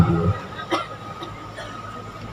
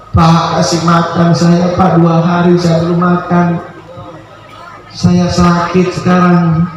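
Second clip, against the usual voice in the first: high, slow, weak begging; pleading beggar; 8 semitones higher than usual. Acted. Starving beggar begs the rich man for food, pleading.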